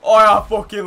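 loud, slow delighted shout with laughter; thrilled shout. Real, not acted. Gamer shouting with delight at something sick.

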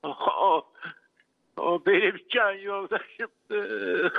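sobbing, low and slow; weeping in real grief; crying. Real, not acted. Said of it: "ağlamak geliyor içimden".

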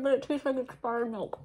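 muffled through fingers; talking while chewing; hand over mouth. Real, not acted. Seen 0: Left speaker covers mouth with hand, likely still chewing pastry.

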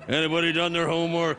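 lightly voiced dad role; a hint of dad checking up; nagging dad. Host playing The Walking Dad, mild dad voice. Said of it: "a show called The Walking Dad".

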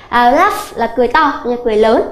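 ordinary talk

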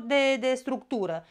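ordinary talk

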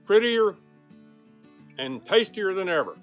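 ordinary delivery

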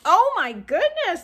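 high, loud, slow exclamation of delight; delighted surprise. Real, not acted. Vlogger unwrapping a cake server, delighted surprise.

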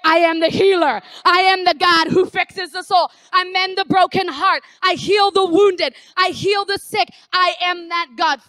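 loud, declaiming voice of God; the voice of God booming out to a hurting believer; God proclaiming. Acted. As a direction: proclaim it with full authority, ringing out. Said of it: "what God's gonna say".

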